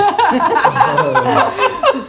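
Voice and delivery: high, loud laughing fit; long peal of high laughter. Real, not acted. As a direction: burst out in helpless high laughter. Laughing at the overheard shout.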